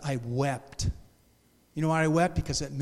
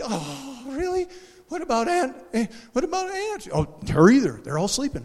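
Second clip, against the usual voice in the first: mock surprised dialogue; 8 semitones higher than usual.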